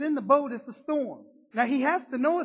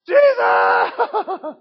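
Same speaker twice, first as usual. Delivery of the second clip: high, loud shout for help; desperate cry; 12 semitones higher, 13 dB louder than usual. Retold or imitated. Peter sinking in the storm, screaming loud for Jesus. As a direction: scream the name like you're going under.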